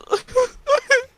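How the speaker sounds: shrieking giggle; high-pitched laughing fit; high squealing laugh. Real, not acted.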